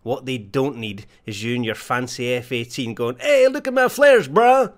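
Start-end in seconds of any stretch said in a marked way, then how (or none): 3.2-4.7 high, loud bragging show-off voice; show-off pilot. Retold or imitated. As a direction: brag like a cocky hotshot pilot.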